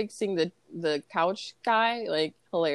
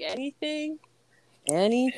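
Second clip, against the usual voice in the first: high, lifted "Anything?" repeated as a query; imitated incredulous host; questioning "Anything?"; 6 semitones higher than usual. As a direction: echo "Anything?" in mock disbelief. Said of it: "I used to be like".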